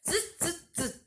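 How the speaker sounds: short, sharp "tsz, tsz, tsz"; monkey "tsz" noises. A narrator's reading. Storyteller mimics the monkeys for young listeners, high hissed "tsz". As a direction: imitate monkeys with high little hissed bursts.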